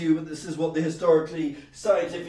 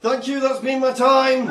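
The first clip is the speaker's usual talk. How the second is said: high, loud slug comic sign-off; slug comedian; 9 semitones higher, 9 dB louder than usual. Acted. Slug signing off to the audience, high loud call. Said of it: "if a slug did comedy".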